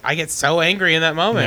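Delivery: ordinary talk, nothing marked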